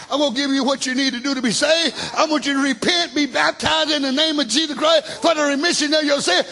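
normal talking voice

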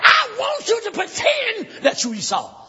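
high-pitched, impassioned sermon shout; full-force preaching. Acted. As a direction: drive it home to the congregation.

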